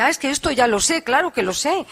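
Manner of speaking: a playful put-on of the listener's self-assured recognition; mock listener's realization. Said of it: "usted dice".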